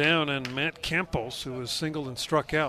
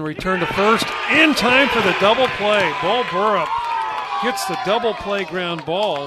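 rising, louder, thrilled double-play call; excited play call; 5 semitones higher, 8 dB louder than usual. Real, not acted.

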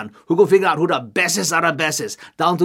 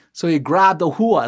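ordinary delivery, nothing marked

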